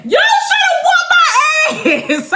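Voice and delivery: high, slow, laughing yell; laughing shout. Real, not acted. Laughing at her own dare.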